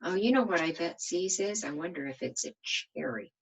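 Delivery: usual speaking voice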